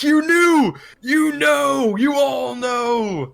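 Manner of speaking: high, loud, drawn-out wild cries; screaming. Acted. Mad prophet screams at onlookers while dragged away. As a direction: scream the accusation, stretching the last word. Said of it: "He just keeps screaming".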